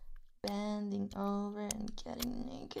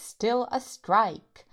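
slow, much louder, every word hammered; furious emphasis; 10 dB louder, much slower than usual. Acted. Ending the rant on the strike, slow and very loud. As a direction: spit out the words slowly in rage. Ranting about channel strike.

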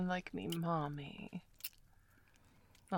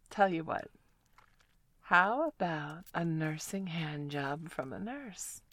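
ordinary delivery

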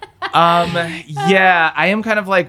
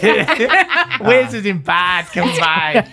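put-on Boston accent; 2 semitones higher, much slower than usual. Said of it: "in a Boston accent".

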